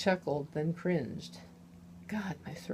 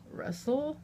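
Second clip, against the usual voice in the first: croaked; 9 semitones higher than usual. Acted. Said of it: "I croaked".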